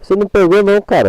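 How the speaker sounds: loud, high, outraged protest; indignant yell. Real, not acted. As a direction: shout back at the screen in disbelief. Protests the game's catch. (grito indignado)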